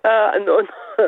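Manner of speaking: ordinary delivery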